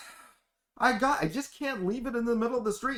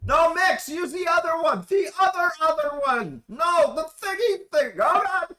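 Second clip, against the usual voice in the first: flustered; 8 semitones higher, 4 dB louder than usual. Acted. NPC panics over phone.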